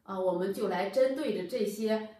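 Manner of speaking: ordinary delivery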